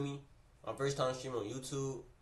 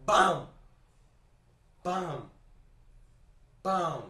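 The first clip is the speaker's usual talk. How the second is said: loud mock impact shouts; three loud bam hits; punch sound effects; 5 semitones higher, 9 dB louder, much slower than usual. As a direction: slam out each hit. Retelling being punched repeatedly.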